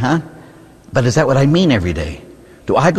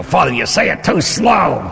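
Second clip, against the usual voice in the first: complaining parishioner; 7 semitones higher than usual. Retold or imitated. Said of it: "And they go".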